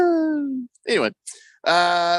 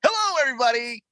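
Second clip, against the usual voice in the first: Willy Wonka greeting; 10 semitones higher, faster than usual. Retold or imitated.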